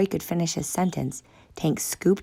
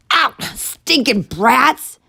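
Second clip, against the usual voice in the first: slow, loud, high snarl at the brats; angry shout; 10 semitones higher, 7 dB louder, much slower than usual. Acted. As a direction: shout them out, slow and harsh. Guard throwing kids out.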